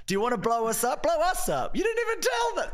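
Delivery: hammed-up high shouty plea; mock plea. Irish voice begging to be noticed.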